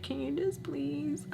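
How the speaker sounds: high, slow, whiny plea with a laugh breaking in; a whiny, near-tears plea to the husband; exasperated whine. Retold or imitated. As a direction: plead like you're about to cry from frustration. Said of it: "it drives me insane".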